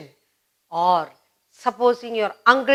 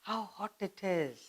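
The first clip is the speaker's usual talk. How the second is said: much softer, fading "How hot it is"; weary complaint; 16 dB quieter than usual. Shown as a demonstration. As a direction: sigh "How hot it is", worn out by the heat. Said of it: "You cannot bear it".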